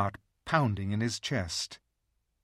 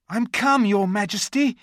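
eager; 11 semitones higher, 10 dB louder than usual. A narrator's reading. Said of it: "rushing eagerly forward".

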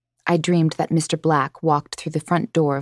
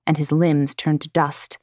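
ordinary delivery, nothing marked

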